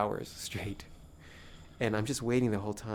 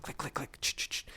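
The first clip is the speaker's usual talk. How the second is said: soft rapid-fire shutter clicks; quiet mouth-made clicking and ch sounds; camera shutter imitation; 8 dB quieter than usual. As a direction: imitate a pack of cameras snapping. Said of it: "they're going, like, click".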